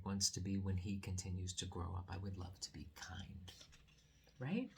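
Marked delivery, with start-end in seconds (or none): 2.1-3.5 soft whisper of a wish. Real, not acted. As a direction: breathe it like a secret.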